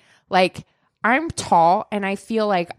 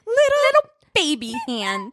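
mocking baby voice; 13 semitones higher, slower than usual. Mocking tiny hands.

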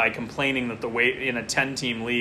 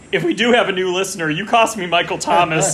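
louder, faster playful blame; mock accusation; 4 semitones higher, 7 dB louder than usual. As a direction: point the finger in jest. Jokingly blaming a new listener.

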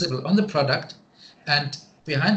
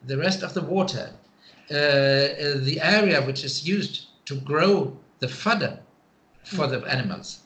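ordinary talk

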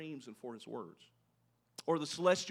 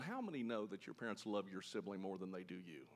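unvoiced whisper to the congregation; hushed aside; 8 semitones lower, 10 dB quieter than usual. Real, not acted. Preacher whispering a knowing aside to the congregation. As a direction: lean in and whisper it.

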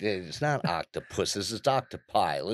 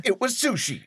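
excited; 13 semitones higher than usual. Acted. Punchline announced to audience.

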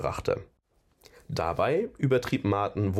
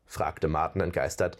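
usual speaking voice

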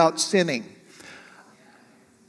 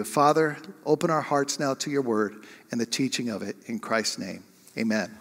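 low, soft prayer; hushed, reverent petition; 5 semitones lower, 7 dB quieter than usual. Real, not acted. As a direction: lower and quieten into a closing prayer. Closing a short prayer.

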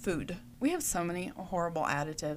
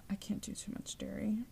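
quick whispered aside about dairy; 10 dB quieter than usual. Real, not acted. Whispering a confession to viewers, unvoiced. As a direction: drop to a breathless whisper, like sharing a secret.

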